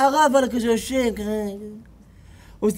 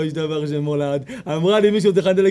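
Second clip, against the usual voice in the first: normal talking voice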